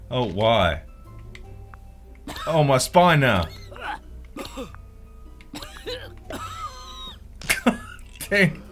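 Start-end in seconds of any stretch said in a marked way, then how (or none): 3.5-8.2 breathless high wheezing laugh. Real, not acted. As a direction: laugh till no voice comes. Huge laugh at game fail.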